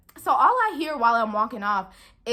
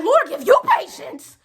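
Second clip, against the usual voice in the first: retelling a blow-up at a rude customer, pitched way up; mock outraged comeback; 6 semitones higher than usual.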